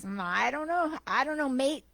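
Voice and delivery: lightly voiced Australian taxi driver; attempted Aussie accent. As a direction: have a go at an amateur Aussie accent. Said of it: "if I can do an Aussie accent".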